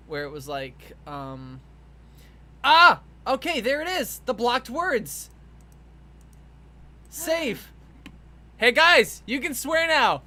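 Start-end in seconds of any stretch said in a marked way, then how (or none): 2.6-7.7 sudden loud excited outburst. Real, not acted. Finds the swear filter setting. 8.6-10.2 loud announcement. Real, not acted. Announces swearing allowed to chat.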